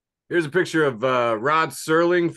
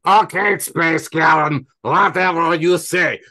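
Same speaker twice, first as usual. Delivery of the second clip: put-on drunk ambassador; 2 semitones higher, 5 dB louder, slower than usual. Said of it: "the drunk ambassador guy is going".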